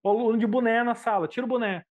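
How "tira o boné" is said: imitated teacher order.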